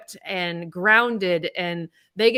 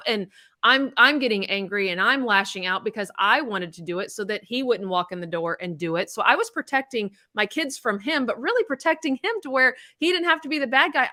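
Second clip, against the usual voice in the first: usual speaking voice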